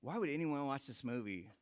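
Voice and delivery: put-on low, soft, unimpressed kid; son's flat robot question. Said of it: "My little robot, he's like".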